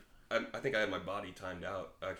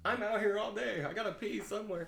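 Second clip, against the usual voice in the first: exasperated plea; 11 semitones higher than usual. Retold or imitated. Retold plea about locked bathrooms.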